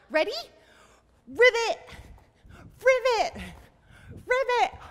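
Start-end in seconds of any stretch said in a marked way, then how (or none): 1.3-4.8 high, loud croak-word on a jump; frog ribbit. Pretend. Teacher hops with children as a frog, loud high ribbit. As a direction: leap and burst it out like a frog. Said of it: "Let's ribbit all the way".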